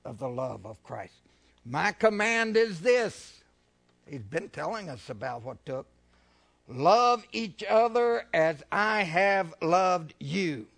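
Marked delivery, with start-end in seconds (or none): 1.7-3.4 loud, very slow declaration; preaching proclamation. Acted. Proclaiming Jesus' words. 6.7-10.7 loud, drawn-out proclamation of Love each other as I have loved you; forceful preaching. Acted. Proclaiming the commandment.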